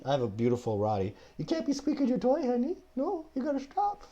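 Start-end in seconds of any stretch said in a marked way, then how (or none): 1.4-4.0 very high sing-song pet voice; talking to dog. Real, not acted. Host telling his dog to quit the squeaky toy, high pet voice. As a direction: coo at the dog to stop squeaking.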